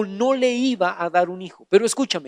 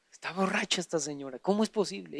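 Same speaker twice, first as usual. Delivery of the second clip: much softer, lower, quick muttering; muttered aside; 5 semitones lower, 16 dB quieter, faster than usual. Acted. Priest Eli muttering about Ana, much softer. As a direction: grumble it under your breath. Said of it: "Eso fue lo que dijo".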